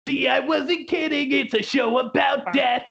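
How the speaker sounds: hammed-up ghoul announcing to the crowd; Beetlejuice voice. Podcaster imitating Beetlejuice teasing the audience, high and hammy. Beetlejuice quote, retold show.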